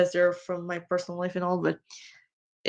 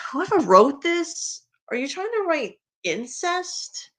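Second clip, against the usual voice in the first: high, slow, appalled question; incredulous; 7 semitones higher than usual. Real, not acted. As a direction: demand it in disbelief. Disgusted question to the writer.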